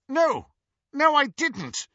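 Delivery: high, loud panicked protest; desperate denial. Acted. Snape desperately denies hurting Petunia, high and loud. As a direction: deny it, frightened and pleading.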